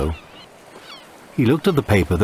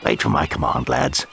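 lower, hushed command; officer; 7 semitones lower than usual. Acted. Lieutenant orders soldiers.

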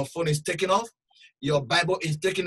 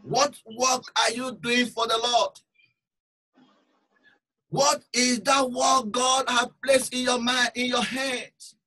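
loud, slow preaching call; fervent preaching; 7 semitones higher, 4 dB louder than usual. Acted. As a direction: hammer the question at the congregation. Preacher exhorting the congregation.

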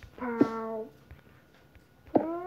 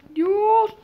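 frustrated shout at the game; raging gamer; 9 semitones higher, 13 dB louder than usual. Real, not acted. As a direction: yell at the glitch. Game glitch frustrates him.

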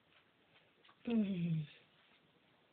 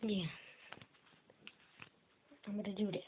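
normal talking voice

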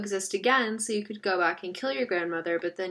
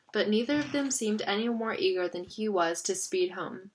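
ordinary delivery, nothing marked

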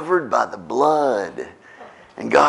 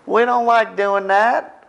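much higher, hesitant coaxing voice; awkward confrontation. Retold or imitated. Believer confronting a brother, high and hesitant. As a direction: ease into it like a reluctant friend. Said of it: "confront somebody and say".

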